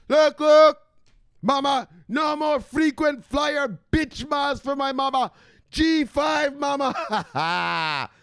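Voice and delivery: high, loud, shouting hype voice; hype-man rant. Acted. Hyped vlogger shouting crypto news at his mama.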